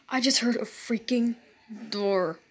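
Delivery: low hushed repeat; quiet disbelief. Real, not acted. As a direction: say it again, hushed and uneasy. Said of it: "repeated quietly".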